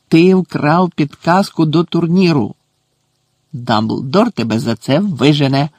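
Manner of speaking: loud bursts of indignation; threatening accusation. A narrator's reading. Caretaker climbing after the poltergeist thief, angrily threatening. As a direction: build to a loud, outraged insult.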